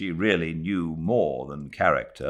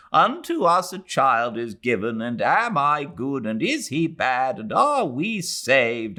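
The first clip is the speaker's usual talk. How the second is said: put-on weary old woman's lament; 10 semitones higher, 4 dB louder than usual. A narrator's reading. Mrs Moore's long weary outburst.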